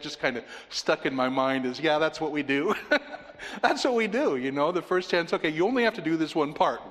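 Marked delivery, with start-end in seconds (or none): 2.8-3.5 brief breathy chuckle at the memory; a quick amused laugh; short laugh. Real, not acted. Laughs at child tearing page. 3.6-4.4 said through a laugh, higher; amused, laughing out the words. Real, not acted. Laughing at child tearing page.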